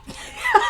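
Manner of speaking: a sudden high burst of laughter; real laugh. Real, not acted. Laughing at her own confusion.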